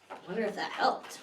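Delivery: ordinary delivery, nothing marked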